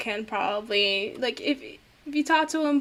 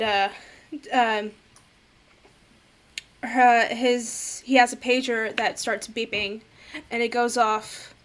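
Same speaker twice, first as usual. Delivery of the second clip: normal talking voice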